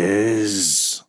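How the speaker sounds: slow, drawn-out monster voice; muttering creature. Acted. Trident creature to the party, slow creature muttering. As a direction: stretch each word out like an eerie creature. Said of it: "The creature mutters".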